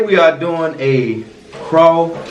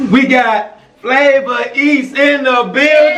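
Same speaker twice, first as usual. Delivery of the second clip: hype announcer; 11 semitones higher than usual. Acted. Hype intro of guest.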